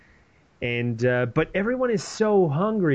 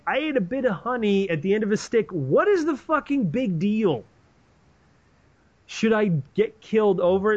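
indignant son; 6 semitones higher than usual. Retold or imitated. Jonathan defends eating honey.

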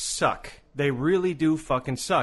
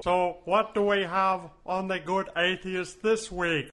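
alien voice; 4 semitones higher than usual. Acted. Alien persona asking.

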